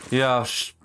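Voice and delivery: ordinary delivery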